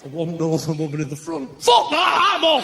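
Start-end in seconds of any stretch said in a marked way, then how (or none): 1.6-2.6 high, loud comic outburst; hammed-up indignant exit; mimicked loud refusal. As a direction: blurt it out as if bolting for the exit. Imitates northern woman fleeing.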